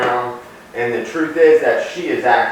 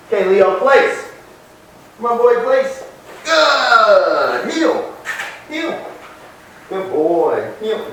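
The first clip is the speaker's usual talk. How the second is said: dog commands; 8 semitones higher, much slower than usual. Real, not acted. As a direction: pitch each command up for the dog.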